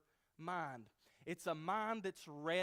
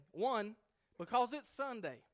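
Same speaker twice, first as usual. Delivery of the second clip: raised, insistent mother voice; 8 semitones higher, 7 dB louder than usual. Acted. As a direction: push him out of bed, pitched up. Mother tugging at bedsheet.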